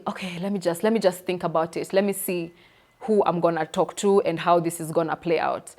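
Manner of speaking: soft, low-key inner monologue; thinking to self. Retold or imitated. Speaker retelling her own stuck thoughts, quiet and hesitant. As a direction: think it through quietly to yourself.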